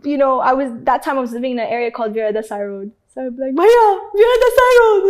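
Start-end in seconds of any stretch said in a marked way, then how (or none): 3.5-5.0 high, loud sobbing directions; tearful call to the rickshaw driver; crying to the driver. Retold or imitated. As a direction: wail the address through tears. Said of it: "And I'd be crying".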